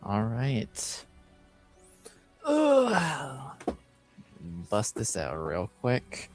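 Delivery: normal talking voice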